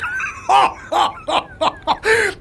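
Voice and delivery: a burst of high laughter at the absurd idea; high incredulous laugh. Acted. The boss laughs off scrapping his fastest engine, high laugh.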